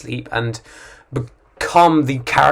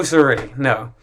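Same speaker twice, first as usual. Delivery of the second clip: normal talking voice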